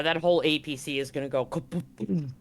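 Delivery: ordinary talk, nothing marked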